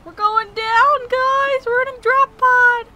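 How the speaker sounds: high, loud thrilled yelling; gleeful panicked shout; excited shout. Real, not acted. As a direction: holler like a ride is dropping. Drop pod plunging to the ground.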